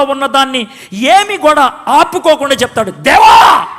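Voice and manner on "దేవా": loud desperate cry; crying out to God. Acted. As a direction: call out to God at full voice. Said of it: "ఏంటి మోషే అరుస్తున్నావు".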